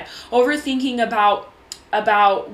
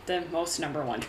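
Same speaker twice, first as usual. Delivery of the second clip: low, much softer repeat to yourself; muttered self-echo; 6 semitones lower, 9 dB quieter, faster than usual. Real, not acted. Host catches her own odd wording, muttered low to herself. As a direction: drop the voice and echo your own slip.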